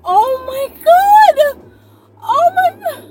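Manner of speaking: high, loud pained crying out; just hit in the groin. Shown as a demonstration. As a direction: wail as if just kicked in the groin. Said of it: "it would be more like this reaction".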